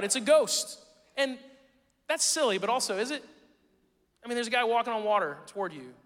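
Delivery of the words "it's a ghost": loud, high frightened cry; cried out in fear. A narrator's reading. Pastor voicing the terrified disciples with a loud cry. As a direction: read it as the disciples' panicked shout. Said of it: "they cried out".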